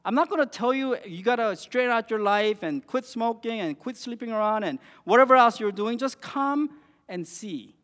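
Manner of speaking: Jesus inviting. Retold or imitated. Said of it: "And Jesus simply says".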